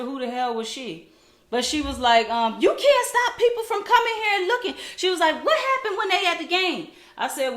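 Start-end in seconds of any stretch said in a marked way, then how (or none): 2.6-4.7 high, loud mimicked protest; mocking imitation. Woman defends the man watching practice, mocking imitated protest. Retold woman arguing with mother. 5.4-6.9 high-pitched mimicked challenge; mocking imitation. Woman argues with the mother, mocking imitated challenge.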